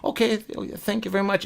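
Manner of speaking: soft, subdued customer thanks. Retold or imitated. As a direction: imitate a customer gone quiet. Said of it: "much quieter now".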